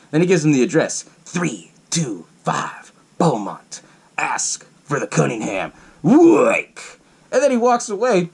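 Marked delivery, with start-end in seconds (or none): 1.2-7.0 very slow, portentous acted delivery; cryptic stranger. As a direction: space out each word like a secret password. Retells stranger giving secret address.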